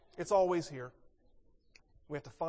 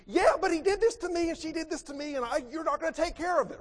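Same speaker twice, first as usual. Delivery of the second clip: put-on very high, loud kid protest; a child whining that it's unfair; whiny child; 10 semitones higher, 6 dB louder than usual. Child objecting to parent, high and whining.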